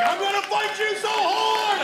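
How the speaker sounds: put-on shouted high and slow, like a kid's empty threat; mock furious shout. Son threatening his dad, slow high mock shout. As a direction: bellow it out slowly like an outraged little kid.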